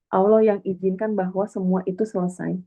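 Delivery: ordinary talk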